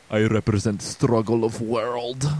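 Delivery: put-on over-the-top Russian accent, strained and declaimed; mock-grandiose drunk Russian scientist; slipping Russian accent. Co-host imitating Granin's cranked-up cutscene, hammed-up Russian accent. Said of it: "he goes from, like".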